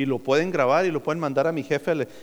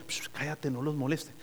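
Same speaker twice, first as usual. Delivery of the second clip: much softer, a hissed hush then a hushed warning; hushed shushing; 3 semitones lower, 12 dB quieter than usual. Acted. Guide in the heaven joke hushing the others, soft shush. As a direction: hush a companion so as not to disturb.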